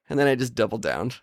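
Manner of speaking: usual speaking voice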